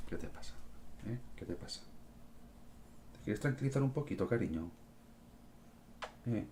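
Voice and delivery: hushed, tender pet talk; calm the cat down softly; soothing the cat. Real, not acted. Streamer soothing his agitated cat, soft and tender.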